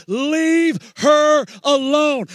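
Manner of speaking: slow, loud drawn-out cry; preached shout. Acted. Pastor voices Jesus to the room in a slow preaching shout. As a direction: hold every word out like a pulpit cry.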